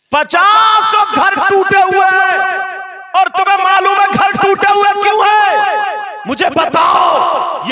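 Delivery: high, loud, drawn-out exhortation; full-force preaching. Real, not acted. Preacher asking why homes are broken, full-force chant. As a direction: hurl the challenge at the crowd in a ringing chant.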